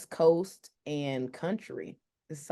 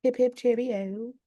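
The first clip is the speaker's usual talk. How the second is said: mock British accent; 7 semitones higher than usual. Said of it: "all these different accents".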